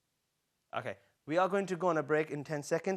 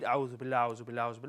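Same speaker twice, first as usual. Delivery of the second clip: imitating students gabbling the phrase, lower-pitched; rushed, careless recital; 4 semitones lower, much faster than usual. Teacher imitating students' wrong way of saying it, rushed gabble. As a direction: run the repeats together in a mumbled hurry. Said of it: "That's not a real way of saying it".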